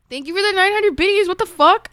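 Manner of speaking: high-pitched, loud disbelief; astonished thanks. Real, not acted. Streamer thanking a viewer for nine hundred biddies, astonished.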